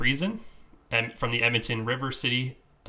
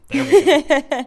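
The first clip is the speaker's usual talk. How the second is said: high, loud laughing words; 12 dB louder, much slower than usual. Real, not acted. Laughing at herself over "adrenaline", words said laughing. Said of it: "I can't say adrenaline".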